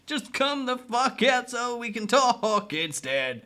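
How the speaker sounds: high, loud, slow taunting yell; mocking shout. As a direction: holler at him to come out, half laughing. Taunting hidden creepy character.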